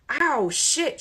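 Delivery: loud high pained ow, shit; shouted. A narrator's reading. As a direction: yell it as she hits the ground. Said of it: "she shouted".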